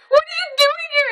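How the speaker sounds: very high, squealed imitation of a friend; amazed squeal. Friend pulling up beside her, high amazed squeal. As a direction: squeal the question in disbelief. Friend's surprised shriek retold, laughing.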